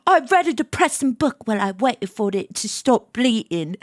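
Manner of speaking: put-on British accent sliding into Cockney. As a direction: try British and drift toward Cockney. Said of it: "put my British accent back on".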